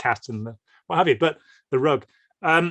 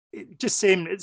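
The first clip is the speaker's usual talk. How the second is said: ordinary talk, nothing marked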